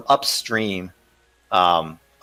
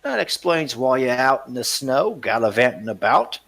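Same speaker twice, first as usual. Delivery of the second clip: mocking smuggler; 6 semitones higher than usual. Smuggler teases the ranger about his reputation. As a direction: needle the ranger with a laugh.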